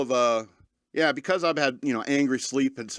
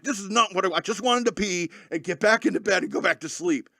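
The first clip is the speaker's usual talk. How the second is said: higher-pitched mock-furious grumble; retold exasperation; 5 semitones higher than usual. Man scrubbing at night, mock-exasperated complaint. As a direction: complain it as if fed up. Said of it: "I'm just so furious".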